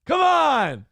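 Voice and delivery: high, loud mock outrage at a pun; exasperated whining. Real, not acted. Groaning at a friend's Autobot pun in high outrage. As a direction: whine it up high like you can't believe him.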